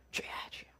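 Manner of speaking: hushed, breathy calling on the Lord; whispered plea. Retold or imitated. Believer calling 주여 in prayer, whispered. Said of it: "삼창".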